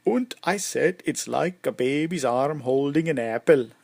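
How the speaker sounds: put-on lively Swedish-accented tavern joker. Bald man telling his crowd a bawdy joke, Swedish accent. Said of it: "sorry, that was my Swedish, uh, accent".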